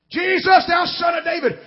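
high, urgent call of a blind beggar; retold loud cry. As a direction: cry out to be heard over a crowd. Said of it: "he's crying loud as he can".

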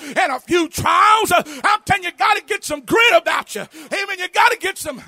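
rally them to get up and fight; full-throated revival preaching; preaching at full force. Real, not acted. Preacher exhorts the congregation.

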